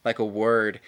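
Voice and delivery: usual speaking voice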